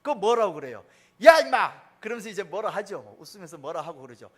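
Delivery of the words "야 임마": loud, high "야, 임마!" like a fond dad; mock scolding. Father teasing his pestering daughter, loud mock scold. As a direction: bark it playfully at a pestering kid.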